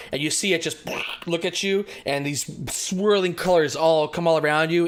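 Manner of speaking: ordinary talk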